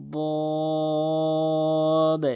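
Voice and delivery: "বদ" held very long and heavy; tongue-edge Dad. Shown as a demonstration. Teacher demonstrating the letter Dad, long sustained "বদ". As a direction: press the tongue's back edge to the upper gum for a long "বদ". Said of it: "জিহ্বার গোড়ার কিনারা উপরে".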